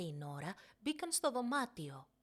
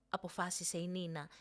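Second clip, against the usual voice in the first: ordinary delivery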